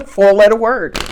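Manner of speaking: said through a laugh; amused, laughing it out. Real, not acted. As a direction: laugh as the card repeats. Laughs at repeated card.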